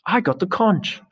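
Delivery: very high, insistent cry; indignant protest. Acted. Piggy clinging to the conch against Jack, indignant high protest. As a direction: protest your right to speak, pitch jumping. Acted quarrel over the conch.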